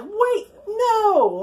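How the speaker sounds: high-pitched retold cry of protest; hammed-up panicked plea; mock alarm. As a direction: act out a startled "no", voice jumping up.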